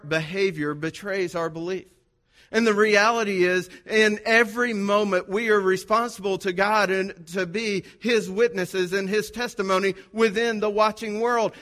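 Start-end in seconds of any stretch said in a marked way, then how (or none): 2.5-11.5 higher, emphatic sermon voice; preaching. Acted. Pastor telling the congregation they are God's witnesses, preaching. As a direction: drive the point home to the pews.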